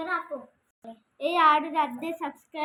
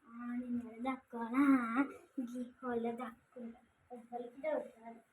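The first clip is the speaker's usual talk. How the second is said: quiet hiding voice, slowing at the end; hushed; 3 semitones lower, 8 dB quieter than usual. Real, not acted. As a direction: speak low so nobody hears. Hiding, speaking softly. (దాక్కుంటూ మెల్లగా)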